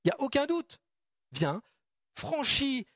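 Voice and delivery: high, eager calls to step out; confident encouragement. Retold or imitated. Preacher voicing Jesus urging Peter onto the water eagerly. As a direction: urge him over the side, no doubt. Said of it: "pour moi, c'était une évidence pour Jésus de dire".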